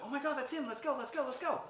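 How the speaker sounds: very high, loud excited squeal; excited urgency. Retold or imitated. Noelle realizing they have found him, excited urgency. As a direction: burst out and rush everyone along. Excited at finding the missing brother.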